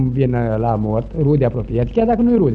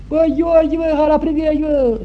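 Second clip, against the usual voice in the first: calling out; 10 semitones higher than usual. Retold or imitated. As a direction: yell for Gheorghe to come in.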